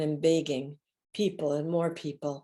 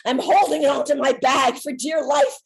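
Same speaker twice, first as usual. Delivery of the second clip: usual speaking voice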